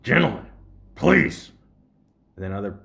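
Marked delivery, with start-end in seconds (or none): 0.0-1.5 loud, formal call to order; stern teacher impression. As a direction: hush the class like a stern teacher. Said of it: "he'd be like".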